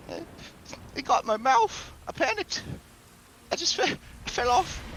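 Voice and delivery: very high, flustered skeleton voice; a rattled skeleton babbling up high; panicky skeleton. Acted. Skeleton explains the bat to the hostess, high and flustered. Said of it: "he says".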